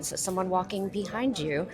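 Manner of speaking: ordinary delivery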